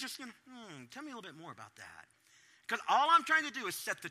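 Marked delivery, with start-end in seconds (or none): 0.5-2.1 soft, low, gentle invitation; quiet patient parent; calm listening. Retold or imitated. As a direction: coax them to keep talking. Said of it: "I just learned with my kids".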